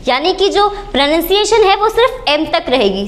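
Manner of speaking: usual speaking voice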